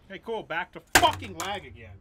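furious shout at the lag; raging gamer. Real, not acted. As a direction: yell it out at the game.

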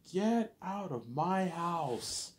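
high, slow furious yell at the son; hammed-up outraged mom; angry parent yelling. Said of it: "my parents are like".

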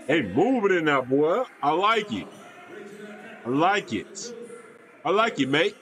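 playful mock English accent, swooping pitch; attempted English accent. Viewer praising a move on the court, attempted English accent. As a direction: have a go at an amateur English accent. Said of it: "trying to attempt my English accent".

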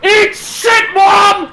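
shrill furious scream. Real, not acted. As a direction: scream it at the top of your lungs. Screaming at mom in game.

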